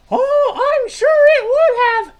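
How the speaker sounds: very high, loud, drawn-out character voice; high-pitched puppet sidekick. Acted. Troy answers Milt's greeting in a very high sidekick voice. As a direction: squeak it out slowly and brightly.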